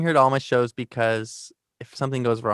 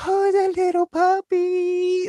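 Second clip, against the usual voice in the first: put-on much higher, slow cooing baby talk; much slower than usual. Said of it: "he goes into that".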